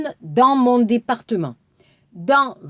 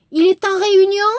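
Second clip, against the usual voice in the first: "Il est en réunion?" pitched high and loud, fast; exaggerated rise; 8 semitones higher, 5 dB louder, faster than usual. Shown as a demonstration. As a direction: push "réunion" way up to mark the question. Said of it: "up, up, up". (과장되게 끝을 올리기)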